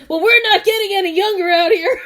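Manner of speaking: loud, high, laughing impatient man; laughing mock yell. Man yelling while laughing.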